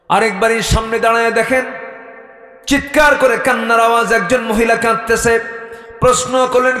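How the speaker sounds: high, loud sermon delivery; full-force preaching. Real, not acted. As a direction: preach it out to a crowd, pitched high.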